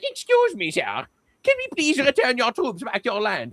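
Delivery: high, timid put-on German voice; meek mock Germans. Germans asking Syria to recall its troops, meek high mock voice. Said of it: "And the Germans are like".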